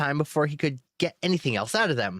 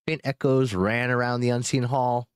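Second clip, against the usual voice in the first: ordinary delivery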